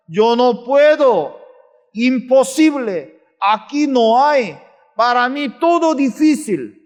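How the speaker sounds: high, emphatic protest of helplessness; defeatist complaint. Acted. Preacher mimics the doubting heart, complaining. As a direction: push out each excuse in a high voice. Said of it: "¿cómo piensa?".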